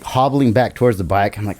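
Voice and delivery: normal talking voice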